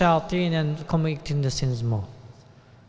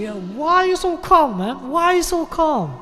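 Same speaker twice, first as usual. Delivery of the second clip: much higher, incredulous voice; puzzled questioning; 8 semitones higher than usual. Retold or imitated. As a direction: act baffled by his calm. Puzzled friend questioning calmness.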